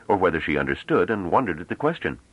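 plain reading voice

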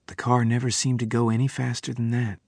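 usual speaking voice

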